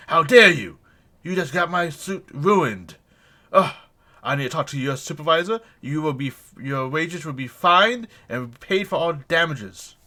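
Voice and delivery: loud, outraged dressing-down of a lowly worker; indignant rich gentleman. Acted. Haughty halfling gentleman yelling at a worker, loud and outraged. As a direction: berate him like he ruined your finest suit.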